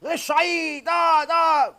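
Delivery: high-pitched accusing shouts from a crowd; imitated angry yelling. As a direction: act out a mob yelling blame, loud and high. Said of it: "yelling at her".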